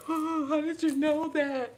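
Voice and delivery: high, loud and slow, stunned; amazed stranger. Retold or imitated. Stranger stunned that she knew their trouble. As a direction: ask it in open astonishment. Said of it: "I've seen people like".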